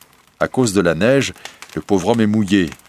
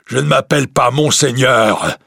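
higher, louder monster voice; beast voice; 6 semitones higher, 5 dB louder than usual. Acted. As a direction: correct him with menace like a beast. The beast corrects the merchant. (la bête reprend le marchand, voix forte et aiguë)

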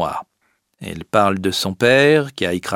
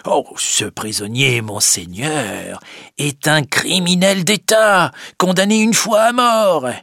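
higher, indignant Boxtel; Boxtel's accusation; 6 semitones higher than usual. Acted. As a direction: accuse the girl boldly. Boxtel denounces prisoner.

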